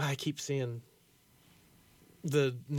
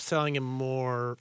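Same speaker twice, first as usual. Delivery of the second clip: normal talking voice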